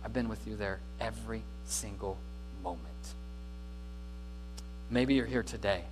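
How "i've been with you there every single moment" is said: gentle voice of God. Retold or imitated. Preacher voicing God answering the complaining believer, soft and tender. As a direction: lower and softer, tender reassurance. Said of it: "God looks at you and says".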